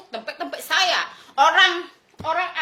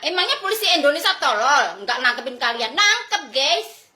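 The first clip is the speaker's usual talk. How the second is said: usual speaking voice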